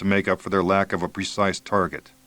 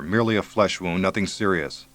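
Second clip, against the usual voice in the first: usual speaking voice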